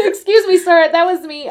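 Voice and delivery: high, loud mock confession with laughter; calling across the room. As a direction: pipe up loudly and high, then settle. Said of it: "from across the room is like".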